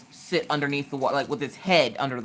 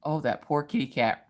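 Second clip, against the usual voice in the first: ordinary delivery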